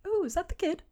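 high, soft character voice; softly cooed question in a high voice; Olos. Acted. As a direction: pitch it well up and keep it light. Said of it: "Olos kinda says".

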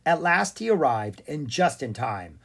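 ordinary delivery, nothing marked

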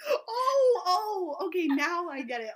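surprised realization. Real, not acted. Getting the horror joke.

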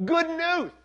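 high, loud lisped cry; Bernie. A narrator's reading. Bernie coming to share his news, shouting with a lisp. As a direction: shout it out high, bursting with excitement. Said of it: "he cried with his familiar lisp".

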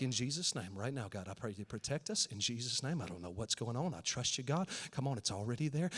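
soft, low, urgent murmured prayer; hushed prayer. Retold or imitated. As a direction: pray under your breath while sneaking. Praying while sneaking out.

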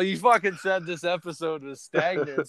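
normal talking voice

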